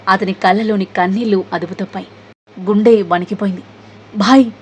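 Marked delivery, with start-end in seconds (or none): 4.2-4.5 calling out high and loud; anguished cry of brother. A narrator's reading. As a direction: shout his brother's name in alarm. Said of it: "అని గట్టిగా అరిచేస్తూ".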